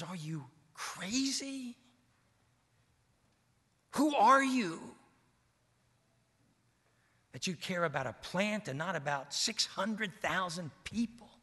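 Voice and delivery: a hint of God scolding; incredulous God. Preacher voicing God rebuking Jonah, mildly incredulous.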